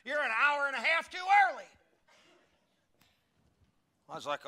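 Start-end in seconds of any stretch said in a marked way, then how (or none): 0.0-1.7 mock shout. Retold clerk scolding him.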